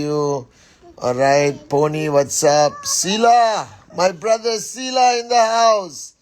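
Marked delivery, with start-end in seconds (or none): 2.8-6.1 hype shout-out. Real, not acted. Hyping up a friend on stream.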